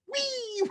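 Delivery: squeaky high whee of zooming past; the sound of a player zipping by; gleeful whee. Retold or imitated. Receiver racing past the defender, squeaky whee. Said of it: "that little guy just went".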